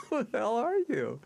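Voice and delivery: retold secretary, high and laughing through the words; laughing disbelief. Secretary laughing at a caller's nerve, laughing disbelief. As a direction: laugh it out, half shocked.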